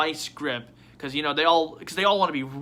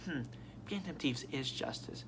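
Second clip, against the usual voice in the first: hushed, much softer mumble; musing bystander; 14 dB quieter than usual. Retold or imitated. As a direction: imitate an onlooker musing under their breath. Voices bystanders musing outside.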